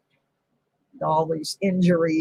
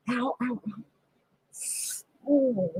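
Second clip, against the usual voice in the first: pained ow; 5 semitones higher, 11 dB quieter, much slower than usual. Real, not acted. In pain while bending down.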